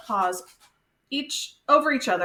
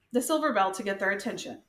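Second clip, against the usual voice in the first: ordinary delivery, nothing marked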